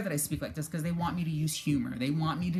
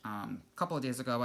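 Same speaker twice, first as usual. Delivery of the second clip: ordinary talk, nothing marked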